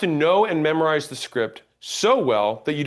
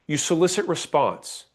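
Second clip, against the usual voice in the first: normal talking voice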